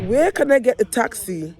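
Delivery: ordinary talk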